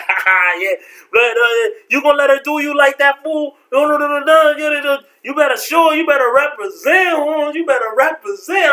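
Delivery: high, fast taunting brother voice; high-pitched teasing cheer from the sidelines; mocking brothers. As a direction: egg him on while laughing.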